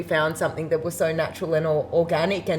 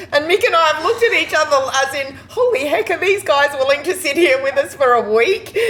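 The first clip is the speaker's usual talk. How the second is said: higher, fast, amused disbelief; mock shock; 9 semitones higher, 4 dB louder, faster than usual. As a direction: retell the shock with a laugh in it. Retelling shocked glance, laughing.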